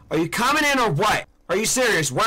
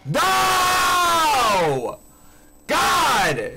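panicked scream; 11 semitones higher than usual. Real, not acted. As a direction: scream in fright. Caught by the monster.

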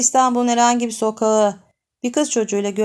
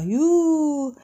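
mock boo; 6 semitones higher than usual. Boy booing the man.

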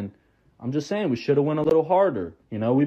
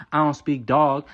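ordinary delivery

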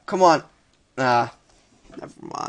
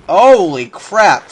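loud, slow, higher outburst; startled exclamation; 4 semitones higher, 10 dB louder, slower than usual. Real, not acted. Player almost killed in the game, loud startled exclamation. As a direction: blurt it out loud, stretching the words.

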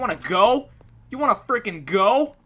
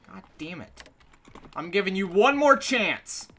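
ordinary delivery

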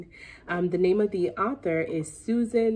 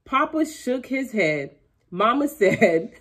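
ordinary delivery, nothing marked

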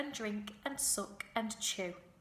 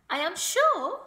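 high, loud proclamation; formal announcement; 10 semitones higher, 12 dB louder than usual. A narrator's reading. Charlotte announcing the goslings' arrival to the barn, formal announcement. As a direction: announce it grandly, pitched up. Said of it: "made the announcement".

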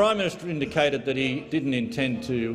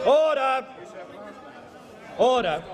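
shouting order order; 7 semitones higher, slower than usual. Real, not acted. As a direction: bark order over the noise. Speaker calling the House to order.

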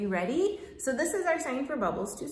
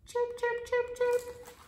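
high tiny chirps; squeaking chicks; 12 semitones higher than usual. Acted. Teacher squeaking as baby chicks. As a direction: peep like baby birds. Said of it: "squeaked the baby chicks".

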